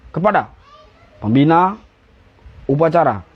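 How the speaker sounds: short, separate words with pauses between; aba-aba upacara. Shown as a demonstration. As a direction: give it as a brisk drill command, no dragging. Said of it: "jangan kayak anak S D gini". (aba-aba tegas, tidak diseret)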